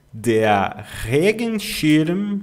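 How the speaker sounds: Regenschirm drawn out with the stress on Re; very slow stress. Shown as a demonstration. Teacher models word stress slowly for learners. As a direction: stretch Regenschirm, lean on Re. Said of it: "Eu coloco o acento aqui no ponto de entonação".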